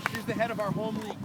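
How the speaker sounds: ordinary delivery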